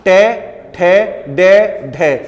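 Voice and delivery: टे ठे डे ढे each said apart with the tongue tip curled back; retroflex sound demo. Shown as a demonstration. Teacher showing the retroflex row to students, syllable by syllable. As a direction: tap out टे, ठे, डे, ढे one by one with pauses. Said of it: "जीभ की जो अगली नोक होगी".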